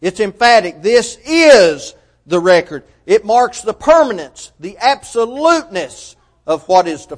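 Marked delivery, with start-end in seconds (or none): 0.8-2.8 "is" leaned on, slow and firm; stress on IS. Shown as a demonstration. Preacher stressing the manuscript's emphatic is, slow and firm. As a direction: land on "is" and hold it. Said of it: "is" is emphatic".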